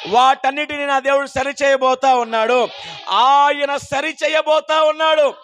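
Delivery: building declaration; preaching at full force. Acted. Preacher assures the suffering, full-force preaching. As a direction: climb to a shouted promise.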